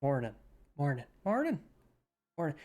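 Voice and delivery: Northern Irish accent warm-up. Shown as a demonstration. Streamer repeating a word to get into the Irish accent. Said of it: "find the word to get into the accent".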